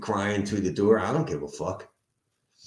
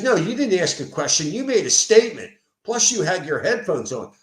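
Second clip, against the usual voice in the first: high, louder mock protest; insistent retold rebuttal, pitched up; argumentative comeback; 8 semitones higher, 5 dB louder than usual. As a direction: imitate a defensive tenant arguing back. Quotes the tenant arguing back.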